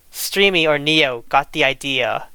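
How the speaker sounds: normal talking voice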